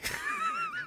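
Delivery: high-pitched laugh. Real, not acted. Laughing at his own embarrassing pub story. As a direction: crack up, high and soft.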